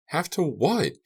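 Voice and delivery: high, angry outburst; angry scream. A narrator's reading. Carrie to his captor, screaming in anger. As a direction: snap it at her in fury. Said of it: "Carrie screamed angrily".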